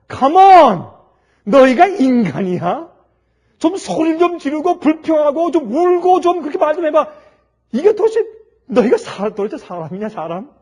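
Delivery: loud, high outraged yelling; exasperated shout. Acted. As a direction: yell at them in disbelief. Atheist operator exploding at couple.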